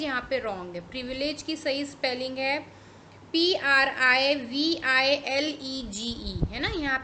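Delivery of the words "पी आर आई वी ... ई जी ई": spelling out. Shown as a demonstration. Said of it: "spells the word letter by letter".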